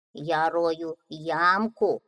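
slow little duckling voice. Acted. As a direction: draw it out like a duckling. Duckling speaks.

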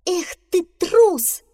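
high, loud, slow scornful cry; angry. A narrator's reading. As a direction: scold a coward. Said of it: "рассердилась белка". (сердито, с презрением)

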